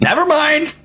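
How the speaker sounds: put-on high, loud old man's retraction; old man backing down. Old Tim calls off the guards, high loud voice. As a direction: call it off in old Tim's high voice.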